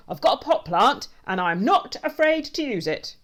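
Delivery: high, loud bravado; blustering threat. A narrator's reading. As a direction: shout it with comic bluster. Man threatens intruder with plant.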